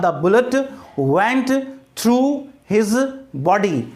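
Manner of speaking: very slow, a gap after each word; word-by-word example. Shown as a demonstration. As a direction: dictate the sentence to a class, one word at a time. Teacher repeats example word by word.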